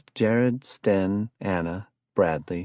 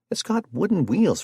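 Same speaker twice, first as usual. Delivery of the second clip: put-on lightly raised conspiracy-minded young man; excitable Jared; 12 semitones higher, 4 dB louder than usual. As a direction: sell the curse theory with eager alarm. Jared's voice again.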